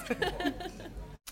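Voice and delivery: high, soft chuckle; laughing. Real, not acted. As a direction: chuckle happily at the performance. Pleased after the accent demo.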